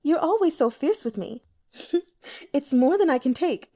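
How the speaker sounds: put-on a courtesan fawning over an old client; flirtatious courtesan. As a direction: flatter him with false modesty.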